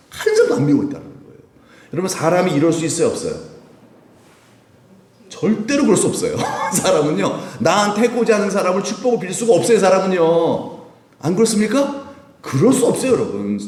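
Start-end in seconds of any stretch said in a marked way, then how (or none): 5.3-13.1 much higher, louder insistence; fervent preaching. Real, not acted. Preacher to congregation, fervent insistence. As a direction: hammer home that no one can.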